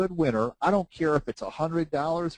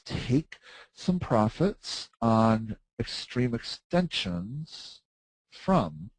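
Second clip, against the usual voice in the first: slow rereading; much slower than usual. Real, not acted. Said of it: "rereading question slowly".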